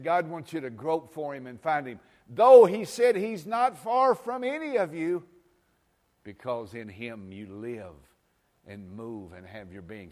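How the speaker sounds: preaching at full force. Acted. Quotes Paul at full preaching force.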